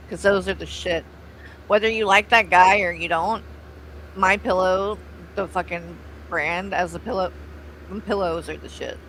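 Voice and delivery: ordinary talk, nothing marked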